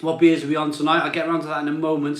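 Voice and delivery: normal talking voice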